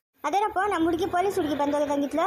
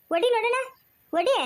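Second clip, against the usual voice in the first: defiant dare; 7 semitones higher than usual. Acted. Wife dares husband to slap.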